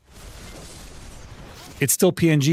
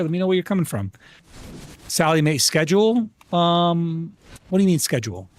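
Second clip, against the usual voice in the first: normal talking voice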